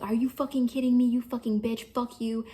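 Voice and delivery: imitating someone's angry insults; retold angry outburst. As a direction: act out an indignant outburst, not full force. Brother's angry outburst in retold quarrel.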